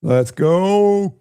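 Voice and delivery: high, loud, drawn-out GO; excited cheer. Real, not acted. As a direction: cheer the player on, stretching the last word. Cheering a player on.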